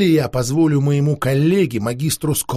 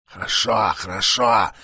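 hissing; 6 semitones lower than usual. Acted. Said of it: "прошипел вампир".

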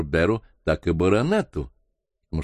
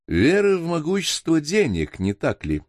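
mildly ironic, pitched up then dropping; 9 semitones higher than usual. A narrator's reading. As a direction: chime in with a touch of irony, high start. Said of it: "иронически подхватил Норбер Мони". (с лёгкой иронией)

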